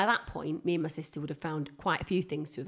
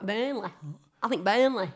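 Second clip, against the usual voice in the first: lightly put-on Burnley Lancashire accent; 2 semitones higher, 4 dB louder than usual. Shown as a demonstration. Host placing her grandma impression, Burnley accent. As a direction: show off a bit of a Burnley accent. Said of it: "I sound a bit more like".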